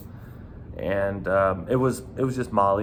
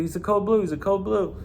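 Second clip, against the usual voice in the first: much higher, urgent repeated call; 10 semitones higher than usual. Retold or imitated. Bouncer alerting buddies to the drugs, urgent and high. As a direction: call it out to the team. Calling code to coworkers.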